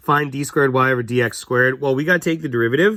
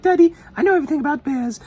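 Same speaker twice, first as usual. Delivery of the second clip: little daughter's voice; faster than usual. Retold or imitated. Teacher imitates his daughter quoting Paddington, childlike. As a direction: chirp it like a small child. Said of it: "what my daughter walks around saying".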